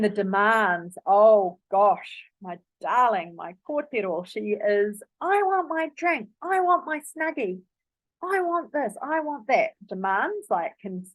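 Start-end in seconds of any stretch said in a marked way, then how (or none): 5.2-9.7 put-on high, harsh demanding little-girl whine; bossy child demanding things, pitched way up; demanding toddler. Daughter demanding her drink and Snuggie, high harsh whine. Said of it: "with a really harsh delivery".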